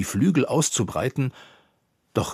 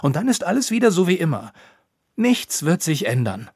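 insistent; 8 semitones higher, 5 dB louder than usual. A narrator's reading. Ropucha insists stubbornly.

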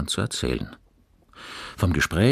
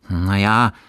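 drawn-out, higher, awkward admission; embarrassed; 5 semitones higher, 8 dB louder than usual. A narrator's reading. Fabian admits doubt about liking animals, embarrassed. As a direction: hedge with some embarrassment. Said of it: "meinte Fabian verlegen".

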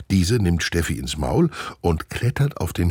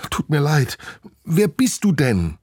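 higher, puzzled apology; apologetic chimp girl; 8 semitones higher than usual. Acted. Chimp Nina apologizes and asks who the glider is. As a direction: ask gently and puzzled.

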